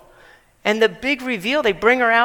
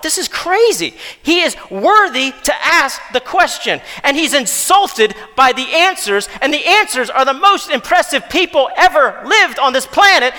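louder, driving pulpit delivery; forceful preaching; 4 semitones higher, 6 dB louder than usual. Acted. Pastor pressing the congregation on Jesus' worth, full-force preaching. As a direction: preach it hard to the room.

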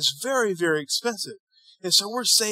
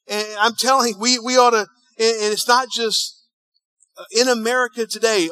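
ordinary talk

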